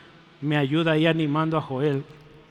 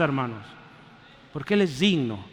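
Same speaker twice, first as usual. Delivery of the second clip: ordinary talk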